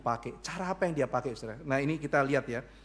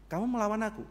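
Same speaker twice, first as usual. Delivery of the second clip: usual speaking voice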